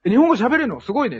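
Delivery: acted pleased surprise. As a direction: re-enact an impressed reply. 海外で驚いて褒める自分を再現. (驚いて感心する声を再現)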